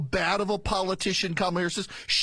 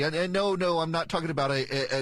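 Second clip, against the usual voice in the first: ordinary talk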